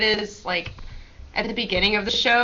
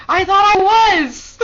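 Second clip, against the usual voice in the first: loud, slow, high whine; loud protest; 10 semitones higher, 9 dB louder, slower than usual. Retold or imitated. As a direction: protest it loudly at a slow pace. Chris protesting loudly.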